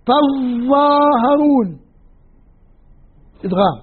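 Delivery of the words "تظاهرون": تظاهرون with a heavy doubled ظ, very slow. Shown as a demonstration. Teacher demonstrates assimilation, ظ held long. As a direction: press and hold the ظ of تظاهرون. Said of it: "تقرأ هكذا".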